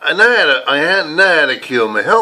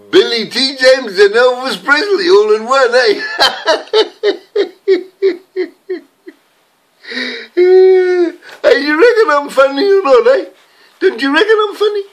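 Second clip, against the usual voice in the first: hammed-up comic voice; 13 semitones higher, 4 dB louder than usual. Hammed-up comic character bit.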